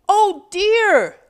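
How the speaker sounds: high, loud alarmed cry; cried out in alarm. Acted. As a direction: gasp it out in dismay. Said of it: "Dave cried".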